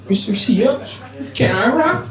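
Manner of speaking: high, whimpering pupil imitation; mock tearful child. Crying student asks teacher a question, mock tearful. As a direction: ask it like a crying schoolboy, put on. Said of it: "he started crying even more".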